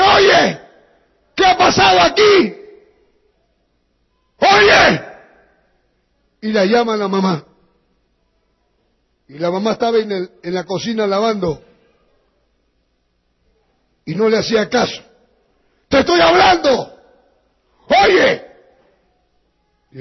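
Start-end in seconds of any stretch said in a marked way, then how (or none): 0.0-5.0 high, loud shouting father; furious father shouting the question; angry shout. Acted. Drunk father finds wrecked house. 15.9-18.5 high, loud demand for attention; angry husband snapping at wife. Acted. Father yelling at ignoring mother.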